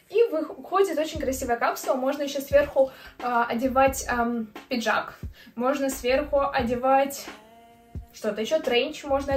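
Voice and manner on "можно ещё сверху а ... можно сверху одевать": ordinary talk, nothing marked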